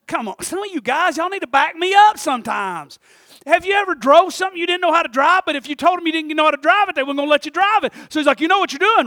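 loud, high preacher's cadence; full-force preaching. Acted. Pastor preaching hard to his church about sowing. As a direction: drive the point home to the pews. Pulls the congregation in.

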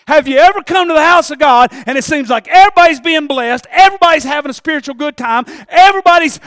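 loud, climbing sermon cry of frustration; fiery preaching. Acted. Preacher crying out to the church about an unsaved son. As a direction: preach it hard, voice straining high.

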